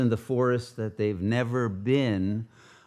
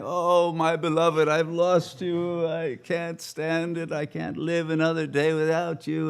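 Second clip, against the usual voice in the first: mock crying; 6 semitones higher than usual. Rama wanders the forest after losing his wife, mock crying. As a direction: wail it like a hammed-up grieving lover. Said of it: "he wanders around crying".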